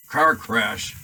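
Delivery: huge, loud outraged roar. A narrator's reading. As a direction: bellow it in disbelief. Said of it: "roared Hagrid".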